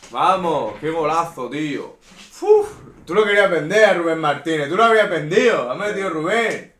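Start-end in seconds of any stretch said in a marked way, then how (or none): 2.4-6.7 loud, high gloating; excited teasing. Real, not acted. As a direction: rub it in at full volume. Taunting partner after a goal.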